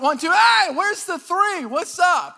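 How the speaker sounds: loud, high mock outrage; indignant protest. Patient protesting the count that skipped three, indignant.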